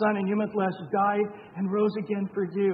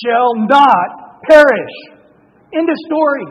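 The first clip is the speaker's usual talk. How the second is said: much louder, slow and emphatic; preaching shout; 6 semitones higher, 15 dB louder, slower than usual. Real, not acted. Preacher declaring the promise to the congregation, full-force shout. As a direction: pound it out from the pulpit.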